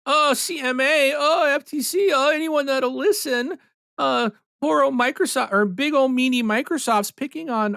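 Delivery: high mocking whine of the poor victim; mocking whiny Sony. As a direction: whine like a bullied little kid, high. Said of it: "sitting there going".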